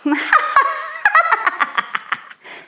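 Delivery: very high, long belly laugh; big laugh. Shown as a demonstration. Teacher showing kids the big laugh that finishes the spell. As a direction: let out a huge happy laugh. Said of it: "you have to do a big laugh".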